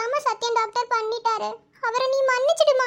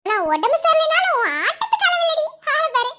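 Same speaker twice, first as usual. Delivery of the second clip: high, raised, playful exclamation; teasing mock scolding; 5 semitones higher, 4 dB louder than usual. Teasing during a playful squabble over nicknames, mock scolding. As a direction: tease with an exaggerated high lilt.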